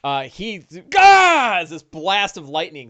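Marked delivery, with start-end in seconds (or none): 1.0-1.6 loud, high yell; orc's pained cry. Acted. Game master voices orc hit by lightning, loud cry. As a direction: cry out as if struck.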